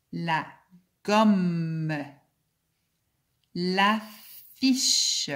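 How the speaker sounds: slow, careful word-list reading, each word set apart; slow dictation. Acted. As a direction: read each word clearly for young learners, pausing between them. Teacher reads word list.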